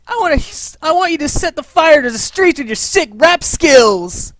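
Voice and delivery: ordinary talk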